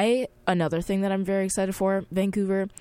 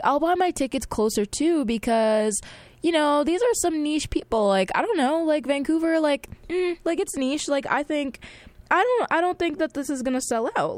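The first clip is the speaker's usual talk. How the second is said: smug inner voice; 9 semitones higher than usual. Retold or imitated. Her inner voice, overconfident.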